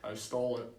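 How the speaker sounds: usual speaking voice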